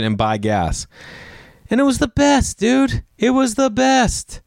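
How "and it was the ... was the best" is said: giddy rave; gushing. Real, not acted. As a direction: squeal it like the best memory ever. Nostalgic praise of running out.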